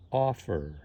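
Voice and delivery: "offer" with the weight on "off"; stress on first syllable. Shown as a demonstration. Teacher showing learners word stress, "offer" stressed on its first syllable. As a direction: lean on "off" in "offer", "er" unstressed. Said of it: "it's the first syllable that's stressed".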